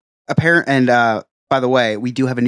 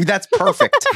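ordinary talk

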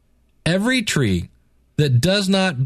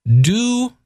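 "do," pitched high and louder; stress on do; 7 semitones higher, 4 dB louder than usual. Shown as a demonstration. Preacher singling out the key word, "do," stressed high. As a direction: punch "do," as the key word, lifting the pitch sharply. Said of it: "there's the word".

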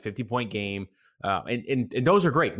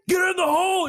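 much higher, louder fan scream; imitated fan shout; 5 dB louder than usual. Cohost imitating the golf clip's sudden fan shout. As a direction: blast it like a fan bursting through the phone speaker. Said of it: "and it goes clink".

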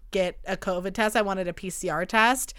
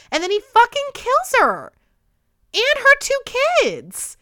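high, loud squeal of outrage; outraged disbelief; 7 dB louder than usual. Real, not acted. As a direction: shriek it in appalled disbelief. Outraged retelling of true-crime killing.

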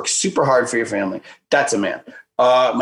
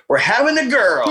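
high, bursting with the news; thrilled phone announcement; excited announcement; 10 semitones higher than usual. Retold or imitated. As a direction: blurt the good news, pitched up.

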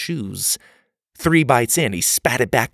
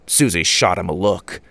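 ordinary delivery, nothing marked

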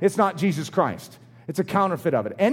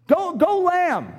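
mock cheering; 8 semitones higher, slower than usual. Mock cheer for a foolish lamb.

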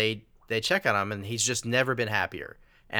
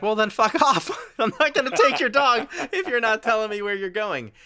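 higher, louder imitation of an exasperated friend, laughing into it; mock angry retort; 13 semitones higher, 4 dB louder than usual. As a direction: throw the retort back hammed up.

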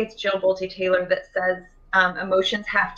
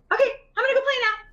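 put-on much higher, fast chirpy kid voice; cheerful child; much faster than usual. A comforted kid tells mom she's off to play, fast and high. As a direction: rattle it off like a bouncy toddler.

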